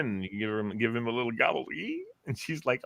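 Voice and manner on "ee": high muffled patient squeak through a numb mouth; tiny high yelp from the dentist chair. Retold or imitated. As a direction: squeak like a patient mid-cleaning.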